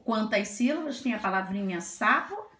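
ordinary talk, nothing marked

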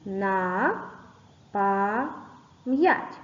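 На па м'ять said syllable by syllable with pauses; split into syllables. Shown as a demonstration. Teacher splits the word into syllables for the class. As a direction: chop На пам'ять into three separate beats. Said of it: "Поділимо це слово на склади".

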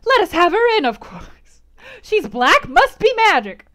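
thrilled at the fun; excited cry. A narrator's reading. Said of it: "cried Frederick Lynn".

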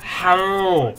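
long, drawn-out loud howl; frustrated wailing. Real, not acted. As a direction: wail the word out, stretching it in exasperation. Losing to Reaper, wailing in frustration.

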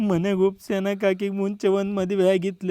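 slow, low, subdued moan; mournful confession. Acted. Husband admitting her earlier marriage, mournful. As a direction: confess it quietly and miserably.

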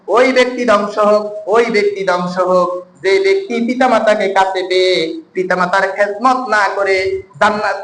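ordinary talk, nothing marked

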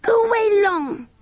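put-on very high, slow toddler voice; drawn-out high baby talk, falling at the end. A narrator's reading. The baby sends the children away, high baby voice. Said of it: "said the baby".

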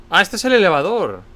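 sudden high alarmed outburst; panicking gamer. Real, not acted. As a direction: yelp it out in a startled panic.

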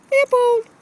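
high, loud call; calling Leopold across the yard; calling the dog. Real, not acted. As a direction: call his name up high.

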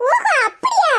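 high, loud exaggerated oh-ho; mock surprise. [name] realising why Aravind is sad, exaggerated surprise. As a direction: overplay the realisation.

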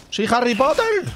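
high, drawn-out mock-heroic cry; playful exclamation. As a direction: shout it out high, playing with the wand. Jugando con la varita. (grito juguetón y agudo)

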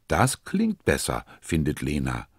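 ordinary delivery, nothing marked

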